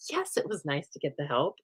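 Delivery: conceding. Acted. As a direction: answer your own question, dropping the volume. Answers her own question.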